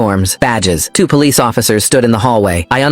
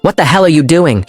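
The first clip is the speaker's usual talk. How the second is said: angry father; 6 semitones higher than usual. A narrator's reading.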